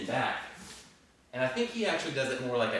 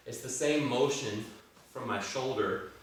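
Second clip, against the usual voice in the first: normal talking voice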